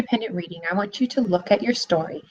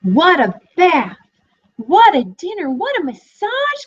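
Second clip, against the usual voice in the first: rising delighted exclamations, the last much higher; cried out happily; 10 semitones higher, 4 dB louder than usual. A narrator's reading. Teacher reading the piglet delighting in his lucky day, excited. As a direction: burst out with glee. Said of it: "cried the piglet".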